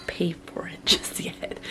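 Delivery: hushed conspiratorial whisper; whispered aside. Real, not acted. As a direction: whisper it like a little secret. Sneaky aside about not paying.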